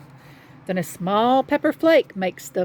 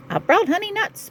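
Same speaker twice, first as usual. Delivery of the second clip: high, pleased little badger; grinning badger voice; 11 semitones higher, faster than usual. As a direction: say it with a grin. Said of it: "Badger says with a grin".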